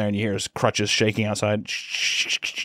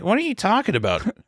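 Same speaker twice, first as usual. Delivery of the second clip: put-on pitched-up baffled teen; high confused girl; 9 semitones higher than usual. Girl questioning her teacher, high. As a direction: sound lost and confused.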